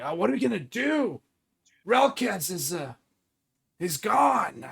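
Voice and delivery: lightly voiced worried player; worried athlete. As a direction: fret about the missing star player.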